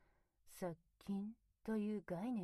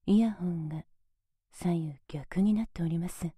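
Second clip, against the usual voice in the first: usual speaking voice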